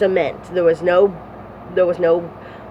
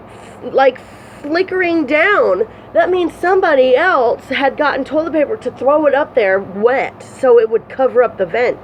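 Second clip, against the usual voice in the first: agitated; 7 semitones higher than usual. Real, not acted. Upset retelling of the cold cell.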